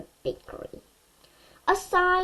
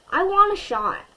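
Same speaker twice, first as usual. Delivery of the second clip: put-on eager kid; 9 semitones higher, 6 dB louder than usual. A narrator's reading. Eager boy begging for a shot.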